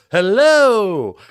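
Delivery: loud, high, drawn-out told-you-so hello; emphatic vindicated hello. Retold or imitated. Telling off the doubting manager, a loud triumphant hello. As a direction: throw a triumphant hello at him. Senior admin confirms his answer.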